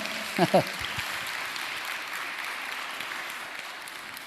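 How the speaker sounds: airy unvoiced laugh; breathy laugh. Real, not acted. Pastor laughs into microphone.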